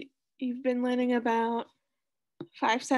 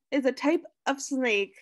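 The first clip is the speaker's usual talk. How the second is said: ordinary talk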